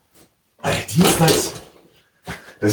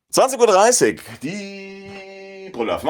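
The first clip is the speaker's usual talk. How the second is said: announcer voice; 9 semitones higher, slower than usual. Acted. Announces show like a trailer.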